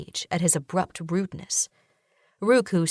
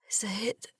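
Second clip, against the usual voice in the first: miserable whisper, much softer; 3 semitones higher, 9 dB quieter than usual. A narrator's reading. Benoit whispering her husband's name, seasick and miserable. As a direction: barely breathe his name in misery. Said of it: "I whisper, miserable".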